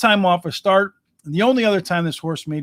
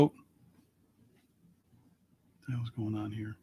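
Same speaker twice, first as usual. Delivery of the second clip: muttering under breath; 7 semitones lower, 17 dB quieter, faster than usual. Real, not acted. Muttering at the dead line.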